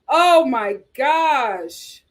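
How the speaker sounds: very slow, drawn-out oh my gosh; a slow exasperated sigh of words. Real, not acted. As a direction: drag it out in disbelief.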